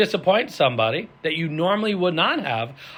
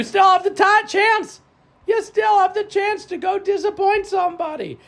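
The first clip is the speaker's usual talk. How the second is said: higher, loud rallying call; excited pitch; 4 dB louder than usual. Real, not acted. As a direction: fire them up to sign up.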